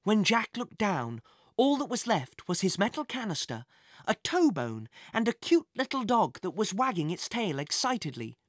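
usual speaking voice

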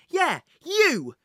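put-on high loud boyish shout. A narrator's reading. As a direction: shout it out boldly. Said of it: "shouted Jack".

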